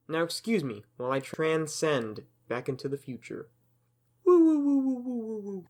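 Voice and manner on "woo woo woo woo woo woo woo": high, loud sci-fi warble; warbling time-machine sound; mock time-travel whoosh. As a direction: wobble it like a cheap time machine.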